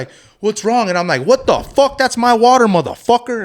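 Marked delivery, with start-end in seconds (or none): 0.4-0.9 startled. Retold or imitated. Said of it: "looks at me like if he's, like, seen a ghost". 1.2-3.4 much higher, louder retold yell; furious yell. Cook confronts the dishwasher over his water, retold furious yell. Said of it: "somebody bitching you".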